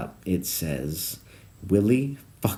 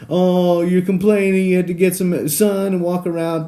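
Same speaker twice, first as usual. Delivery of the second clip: mocking whine; 10 semitones higher, 5 dB louder than usual. Mocking a complaint about sunshine.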